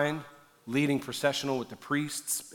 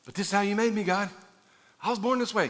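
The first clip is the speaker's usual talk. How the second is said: higher-pitched mock pleading; acted protest; 7 semitones higher than usual. Believer making excuses to God, mock protesting.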